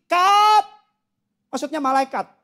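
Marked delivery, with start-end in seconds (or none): 0.1-0.7 seruan "cut" tinggi dan keras; perintah Allah yang dibuat-buat. Acted. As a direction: berseru memberi aba-aba, dilebih-lebihkan. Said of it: "Allah bilang gini". (menirukan perintah dengan suara lantang)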